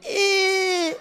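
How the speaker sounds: imitated squeezed-out squeak; strained high squeal. Performer squeezed by python, high strained squeal. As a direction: squeak as if crushed tight. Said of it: "he'd make the same sound".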